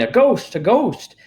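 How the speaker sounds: high, loud cry of fright; panicked yelling. Acted. Townspeople seeing empty clothes float, panicked high yell. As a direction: shriek it like a scared crowd. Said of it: "with everybody yelling".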